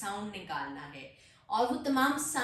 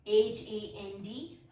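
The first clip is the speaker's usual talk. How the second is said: slow letter-by-letter spelling; 2 semitones lower, slower than usual. Shown as a demonstration. Teacher spelling a word for learners, slow spelling. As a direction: spell it out, a small gap after each letter. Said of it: "letter spell करती हूँ".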